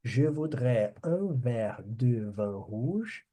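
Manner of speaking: slow, careful French line; slow model for repeating. Shown as a demonstration. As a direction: say it slowly for the class to echo. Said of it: "Quero ouvir vocês pronunciando".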